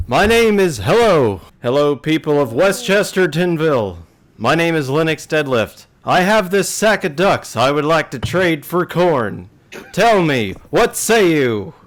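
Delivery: loud, high, hammy proclamation to the whole bar; boisterous announcement. Acted. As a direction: announce yourself grandly to the tavern crowd. Lenny's dramatic tavern entrance.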